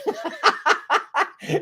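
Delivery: short, high burst of laughter. Real, not acted. Laughing as he remembers the graffiti-covered shop. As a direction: laugh at the surprise.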